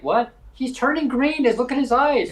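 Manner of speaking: high, alarmed gasp at the TV; mom's shock. Retold or imitated. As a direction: squeal it out like a startled mom watching the Hulk. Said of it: "She's like".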